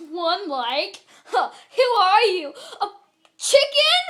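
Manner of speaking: hammed-up jeering disbelief; mocking viewers. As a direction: scoff at it, building to a high, loud question. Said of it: "you guys are like".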